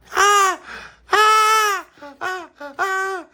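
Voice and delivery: shrill loud screaming; piercing screams of fright; terrified screams. Acted. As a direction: scream in terror at the box. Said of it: "came screaming past".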